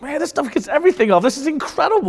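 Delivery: high, delighted gush over the cleaner; amazed. Retold or imitated. Preacher marvelling at hand cleaner, high and amazed. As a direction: marvel like it's a miracle product.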